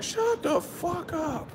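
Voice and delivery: high, slow mock yell at the singer; annoyed neighbors. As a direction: imitate fed-up neighbors shouting back. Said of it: "And they're like".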